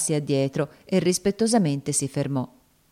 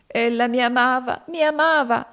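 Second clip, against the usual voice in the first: put-on higher, louder sobbing girl; grieving cry; 8 semitones higher, 3 dB louder than usual. A narrator's reading. Topsy grieving for Eva, sobbing.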